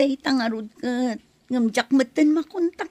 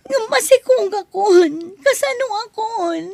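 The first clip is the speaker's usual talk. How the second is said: high, louder desperate plea; pleading and panicked; desperate cry; 7 semitones higher, 4 dB louder than usual. Acted. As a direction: cry out in despair. Desperate pregnant woman.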